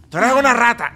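loud, high-pitched imitated outburst; mock accusation. Impressionist acting out the exchange over his video, mock accusing shout.

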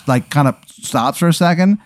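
usual speaking voice

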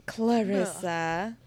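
slow, drawn-out creepy whisper of a name; creepy weirdo voice. Acted. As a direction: stretch it out like a creep. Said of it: "in this creepy weirdo voice".

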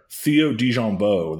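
slow, hammed-up Cajun name; deliberately phony Cajun drawl; fake Cajun accent. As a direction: draw it out in a bad Cajun accent. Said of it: "most obviously fake and probably upsetting Cajun accent".

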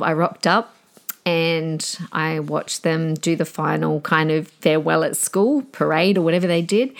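usual speaking voice

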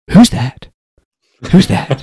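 high, loud mock-clueless "Who's that?"; clueless teen question. Mocking the girl behind them in the theater, high clueless question. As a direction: imitate an oblivious teenager asking loudly. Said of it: "this girl and her boyfriend would go".

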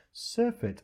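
Surfeit said high "SUR-fit" like the computer; imitating Google. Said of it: "Thank you, Google".

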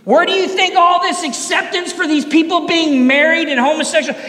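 ordinary talk, nothing marked

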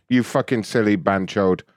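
ordinary talk, nothing marked

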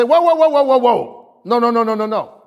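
loud, high string of protest, easing off; hammed-up refusal, fading from a loud burst; mock protest. Guest refusing hairy cookies, mock alarmed protest. Acts out refusal he didn't make.

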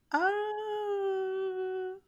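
long high soft howl; drawn-out, high coyote howl; distant coyote howl. Acted. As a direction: howl far off in the night. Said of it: "you hear".

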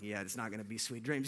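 low, hushed scheming murmur under the breath; sneaky hushed aside. Retold or imitated. Hiding under the bed, muttering to himself, hushed and sly. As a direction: keep it quiet so nobody hears you.

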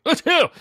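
loud achoo with a squeak after; imitated sneeze. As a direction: sneeze it out loud, then a little noise. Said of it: "there's a small noise right afterwards".

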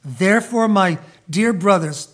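ordinary talk

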